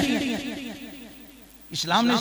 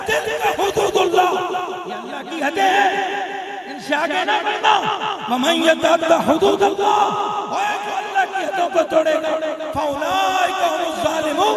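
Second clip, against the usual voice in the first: higher, ringing verse recitation; preaching recitation; 6 semitones higher than usual. Real, not acted. Preacher warning of Allah's limits, fervent high recitation. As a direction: recite the verse, then translate with fervour.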